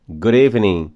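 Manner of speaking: "Good evening." with the D as a tapped R and no final G; linked pronunciation. Shown as a demonstration. Teacher modeling the linked form to students. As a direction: say "Good evening." as "goo-revenin". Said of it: "Assim como good evening".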